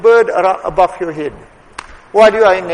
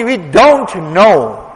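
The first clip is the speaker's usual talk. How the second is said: drawn out slowly, leaning on don't; slow, weighty repeat; slow emphatic; 4 dB louder, much slower than usual. Real, not acted. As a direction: let it sink in slowly.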